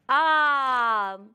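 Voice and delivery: आम with the आ clearly lengthened; long aa. Shown as a demonstration. Teacher shows children the long aa, drawn out. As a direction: hold the आ in आम. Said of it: "हमने आ को लंबा करके बोला".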